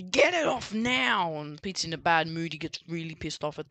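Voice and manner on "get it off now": higher, drawn-out angry order; mock-angry command, stretched out slowly; angry demand. Prank victim to the prankster, angry demand. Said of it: "he gets all angry".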